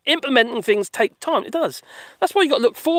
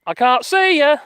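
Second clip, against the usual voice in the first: calling out; 7 semitones higher, 6 dB louder, slower than usual. Real, not acted. Calling the dogs from afar.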